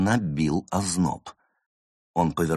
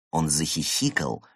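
ordinary delivery

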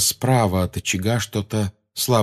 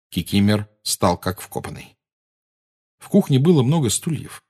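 ordinary delivery

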